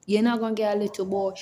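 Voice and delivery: ordinary talk, nothing marked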